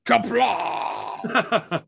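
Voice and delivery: mock Klingon war cry; yelling. Joking to co-host about a Klingon bathroom, yelling. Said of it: "you're just yelling".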